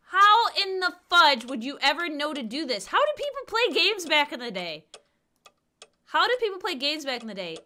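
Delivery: frustrated gamer yelling at the puzzle; exasperated outcry. Real, not acted. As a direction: vent at the ridiculous puzzle.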